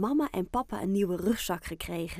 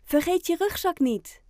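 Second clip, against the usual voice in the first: called up the stairwell, much higher and louder; mother calling upstairs; 10 semitones higher, 6 dB louder than usual. Acted. Mother calls Oscar up the stairwell, raised higher calling voice. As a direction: mum calling her son down from below. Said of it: "roept zijn moeder door het trapgat".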